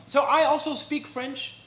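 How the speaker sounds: ordinary talk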